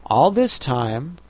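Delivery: ordinary delivery, nothing marked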